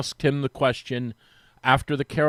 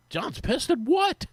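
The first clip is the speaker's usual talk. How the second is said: disbelief; 13 semitones higher, slower than usual. Retold or imitated. Retold phone call, disbelief.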